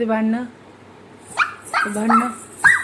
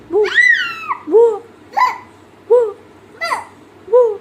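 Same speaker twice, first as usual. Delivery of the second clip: short, separate imitated barks; dog bark; 11 semitones higher, 5 dB louder, slower than usual. Shown as a demonstration. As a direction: bark like a dog, one woof at a time. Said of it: "कुत्रे कसे भुकतं".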